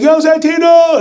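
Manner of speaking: big, high, loud hey-there call; Shaquille O'Neal greeting. Retold or imitated. Shaquille O'Neal greeting the wrestler, a booming high call. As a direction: hail him across the room like an old buddy. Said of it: "he's like".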